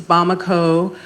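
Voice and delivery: ordinary talk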